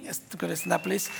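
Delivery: usual speaking voice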